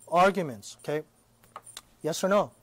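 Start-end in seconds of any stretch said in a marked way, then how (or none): none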